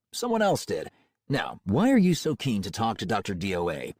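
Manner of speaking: smooth cold-calling salesman; confident, warmly professional pitch. Acted.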